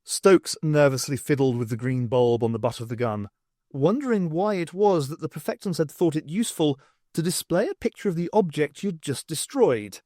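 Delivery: ordinary delivery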